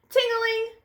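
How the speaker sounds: high sing-song ting-a-ling; bright high bell chime; bell ring. A narrator's reading. Bell sound again.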